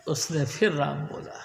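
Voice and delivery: usual speaking voice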